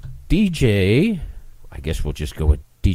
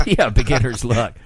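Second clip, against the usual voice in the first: laughing; 3 semitones higher than usual. Real, not acted. Laughing along with guest.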